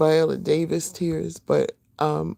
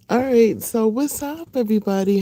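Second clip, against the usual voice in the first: excited greeting; 6 semitones higher, 3 dB louder than usual. Real, not acted. Greeting viewers as she starts.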